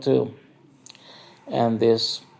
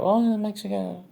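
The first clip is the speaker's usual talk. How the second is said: higher voice for the curious lady; mock lady's voice; 9 semitones higher than usual. The narrator quotes the dancer asking how Mexicans talk, higher mock voice. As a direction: lift the pitch to play her asking. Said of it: "So she was like".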